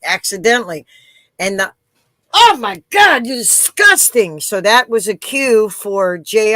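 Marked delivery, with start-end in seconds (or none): 2.3-4.4 high, loud, disgusted squeal; a woman stuck in a lift with a fart smell, disgusted. Retold or imitated. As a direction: play the appalled woman in the lift. Woman's retold reaction in elevator.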